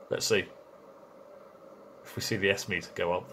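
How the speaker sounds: ordinary talk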